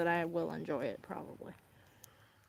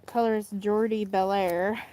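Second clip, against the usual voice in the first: usual speaking voice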